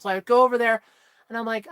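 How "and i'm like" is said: usual speaking voice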